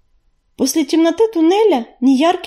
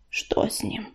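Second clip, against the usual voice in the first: hushed, muffled question; hollow, muted; 3 semitones lower, 11 dB quieter than usual. A narrator's reading. Leader asks after an injured cat, hollow and muted. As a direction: ask it low-key and dull, very soft. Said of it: "глухо спросил он".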